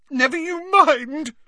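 loud, slow, very high retort; high-pitched indignant ghost girl; defiant snap. Acted. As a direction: shut them down in a shrieky girlish voice. Ghost girl snaps at teasing.

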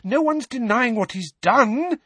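high, loud, agitated mother; voice rising. A narrator's reading. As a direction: argue with your voice climbing. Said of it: "her voice rising".

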